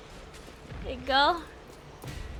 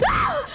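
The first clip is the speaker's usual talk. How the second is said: short, high startled scream; 13 semitones higher than usual. Real, not acted. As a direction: yelp as if jumped. Startled by game attack.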